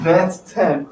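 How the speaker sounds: usual speaking voice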